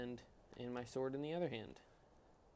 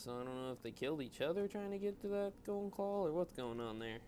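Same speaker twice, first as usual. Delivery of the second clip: ordinary talk, nothing marked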